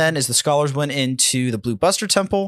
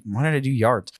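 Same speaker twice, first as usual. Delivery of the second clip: usual speaking voice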